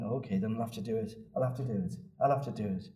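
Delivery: low, soft, quick self-talk; resigned murmur. Retold or imitated. Thomas on each step, quietly resigned. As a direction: talk yourself into it under your breath. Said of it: "every step he must have been going".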